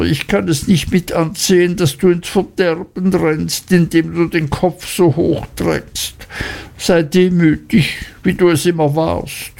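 wailing mother. A narrator's reading. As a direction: lament that you can't help it. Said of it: "jammerte die Mutter".

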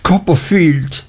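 high-pitched delighted exclamation; calling out. A narrator's reading. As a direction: cry the name on spotting an old friend. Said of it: "rief Traddles, als er aufblickte".

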